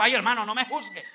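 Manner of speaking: an offended tither snapping back at the preacher, mildly played; offended protest. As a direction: bristle like a man who feels accused. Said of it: "Entonces él se enojó".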